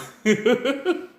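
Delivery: breaks into a high laugh; can't hold the laugh in; short real laugh; high burst of laughter. Real, not acted. Laughing mid description.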